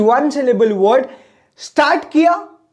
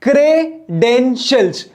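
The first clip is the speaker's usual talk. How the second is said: Credentials slowly as "cre", "den", "shials"; short pause between the three parts of credentials; three measured beats on credentials; split into three syllables. Shown as a demonstration. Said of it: "तीन सांस में बोलूंगा".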